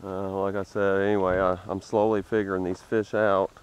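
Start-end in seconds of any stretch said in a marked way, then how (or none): none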